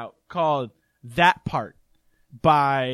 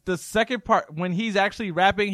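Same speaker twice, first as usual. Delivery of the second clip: ordinary delivery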